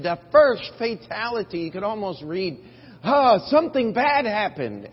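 headline read in loud, high mock alarm; mock the news's panic. Mocking news headline.

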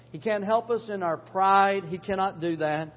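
ordinary delivery

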